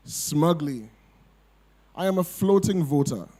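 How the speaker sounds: measured, pointed poem delivery; poetry reading. Acted. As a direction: read it like a protest poem. Reads her poem aloud.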